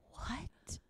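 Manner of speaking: whispered, stunned "What?". Retold or imitated. Telling the story of her mom's reply, a stunned whisper. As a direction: breathe it out in disbelief. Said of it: "I was like".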